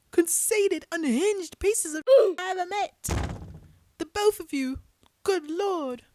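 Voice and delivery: an outraged British rant at Paul and John; furious British accent. Acted. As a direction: tear into both men in a British accent. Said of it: "eating this British accent".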